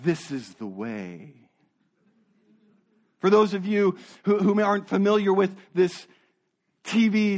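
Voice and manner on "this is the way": low, slow Mandalorian creed voice; solemn Mandalorian catchphrase, deep and deliberate. Retold or imitated. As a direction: drop low and slow like a helmeted warrior. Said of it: "As the Mandalorian would say".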